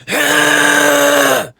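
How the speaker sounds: long, loud, high harsh exhale; head-driven scream. Shown as a demonstration. Coach showing what to avoid, head scream. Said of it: "don't use your head and go".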